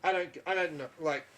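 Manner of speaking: normal talking voice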